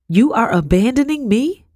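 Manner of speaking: high, wounded disbelief; incredulous. A narrator's reading. Wife learning her husband will leave her, incredulous. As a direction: question him in rising dismay.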